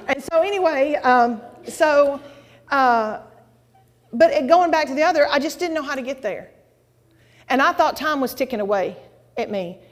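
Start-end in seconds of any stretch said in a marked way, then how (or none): none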